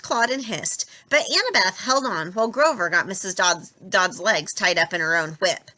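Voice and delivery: ordinary delivery, nothing marked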